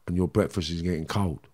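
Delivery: quietly mocking the brother's words; bitter echo. Real, not acted. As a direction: throw the line back with contempt.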